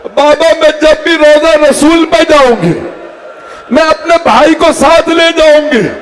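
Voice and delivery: put-on high, sobbing little girl's voice, pleading; tearful daughter's plea. Daughter begging her father not to leave, tearful high plea. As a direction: weep the words as the young daughter.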